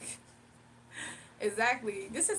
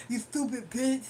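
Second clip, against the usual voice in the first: mocking caller impression. Streamer imitates the hostile caller who rings during lives, mocking. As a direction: imitate the insulting caller, lumbering through the threats. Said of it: "That's exactly how he talks too".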